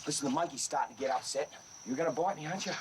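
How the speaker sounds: ordinary talk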